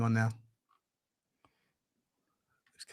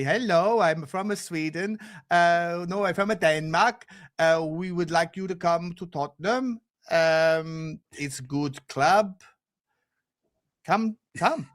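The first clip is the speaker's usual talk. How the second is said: put-on low, halting Swedish accent; hesitant, deadpan Swedish-accented executive; Johan Lang; 8 semitones lower, slower than usual. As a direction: make a stiff, awkward pitch in Scandinavian English. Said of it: "I can't do the Swedish accent".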